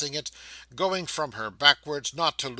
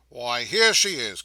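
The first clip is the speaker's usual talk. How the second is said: a loud, slow, delighted exclamation; delighted cry; 6 dB louder than usual. A narrator's reading. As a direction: greet the child with joy. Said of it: "cried Trotty".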